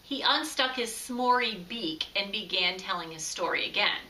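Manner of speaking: usual speaking voice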